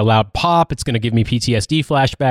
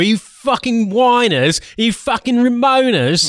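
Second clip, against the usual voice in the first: high-pitched put-on British patriot rant; mock-indignant griping in a raised, put-on voice; aggrieved patriot; 12 semitones higher, slower than usual. Patriot blaming whoever he's mad at, mock-indignant rant. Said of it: "Australian British patriot there".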